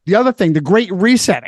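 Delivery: ordinary talk, nothing marked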